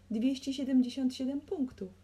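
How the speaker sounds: high, loud tally; shouted score. A narrator's reading. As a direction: call out the total at full voice. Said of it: "krzyknął biznesmen".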